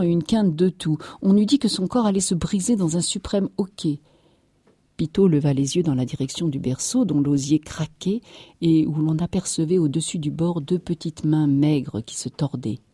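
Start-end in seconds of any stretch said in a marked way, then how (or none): none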